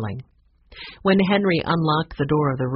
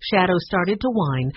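usual speaking voice

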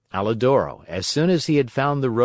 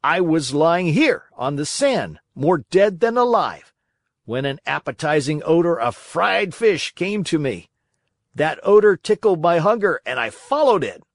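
higher, animated storytelling dog voice; earnest dog recounting; 6 semitones higher, 4 dB louder than usual. A narrator's reading. Dog telling the marionette how he found the cave, animated. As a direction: tell the adventure with emphatic lifts.

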